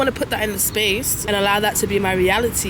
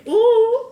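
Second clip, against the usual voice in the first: high laughing ooh; giggly ooh; 13 semitones higher, 6 dB louder than usual. Real, not acted. Laughs at herself.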